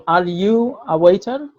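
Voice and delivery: Are you a waiter said very slowly, word by word; slow teacher model; slow model question. Shown as a demonstration. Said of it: "তাহলে আমি বলবো".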